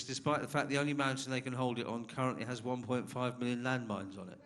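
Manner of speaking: ordinary talk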